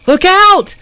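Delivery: a very high, very loud warning shriek. Acted. Zelly warning the others of the skeleton, high loud shriek. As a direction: shriek a warning to your friends. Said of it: "Zelly shrieked".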